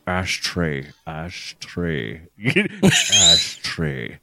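low, soft London actor's voice; slow, hushed Cockney warm-up; Michael Caine. Shown as a demonstration. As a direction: settle down low and draw it out. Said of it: "I can do Michael Caine".

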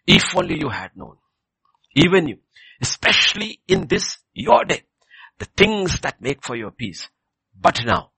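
impassioned lament. Acted. Jesus lamenting over the city that missed its day, loud impassioned cry.

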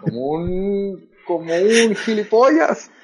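mock Castilian insult, drawn out; slow, high mock Spain Spanish; Spanish accent.

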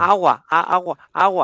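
put-on high, loud, insistent child calling papa; whining toddler. Mother playing her toddler son pestering dad, high loud whine. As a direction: nag for dad's attention like a small boy. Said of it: "el personaje nuevo".

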